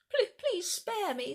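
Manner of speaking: high, slow, trembling plea for mercy; a frightened dinosaur begging high and slow; pleading. Acted. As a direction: beg for your life, stammering. Captured dinosaur begs for mercy.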